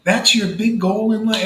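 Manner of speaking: incredulous reaction. Retold or imitated. Retells his incredulous reaction to them.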